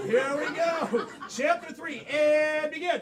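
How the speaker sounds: loud, high, rousing host shout; announcer kickoff. Real, not acted. Host starting chapter three for the performers, announcer kickoff. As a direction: call it out big to start the round. Host launches the next scene.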